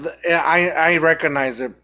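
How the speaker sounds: normal talking voice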